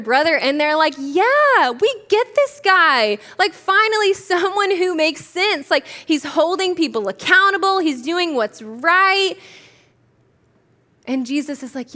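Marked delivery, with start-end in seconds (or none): 1.0-9.3 enthusiastic agreement. Acted. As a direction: cheer him on like a vindicated crowd. Voicing approving listeners of the parable.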